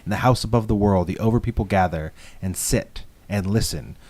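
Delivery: low, hushed literary reading; dramatic reading. Shown as a demonstration. Host reading a favorite Alan Moore passage, hushed. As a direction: read it like a reverent passage. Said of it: "I wanted to read one of my favorite lines".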